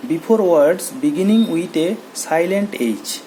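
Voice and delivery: the English rule read very slowly. Shown as a demonstration. Teacher reading the third rule slowly to students. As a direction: dictate the rule word by word. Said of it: "ᱛᱮᱥᱟᱨ ᱞᱟᱜᱟᱛ ᱱᱚᱰᱮ ᱧᱮᱞ ᱛᱟᱵᱚᱱ ᱯᱮ".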